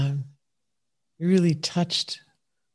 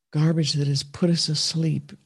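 usual speaking voice

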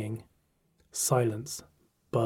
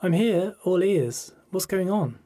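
higher, a touch of eagerness; lightly voiced eager friend; 7 semitones higher, 4 dB louder than usual. A narrator's reading. Friend on her bed asks what's going on, lightly eager. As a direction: lightly play the attentive friend, pitched up.

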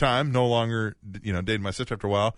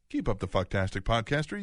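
ordinary delivery

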